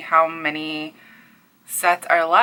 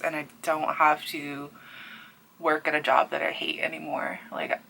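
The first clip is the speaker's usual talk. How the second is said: hushed and softer, close to tears; near tears; 2 semitones lower, 8 dB quieter than usual. Real, not acted. Telling viewers she left a job she hated, hushed near tears. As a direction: whisper it as the emotion rises. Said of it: "I'm gonna cry".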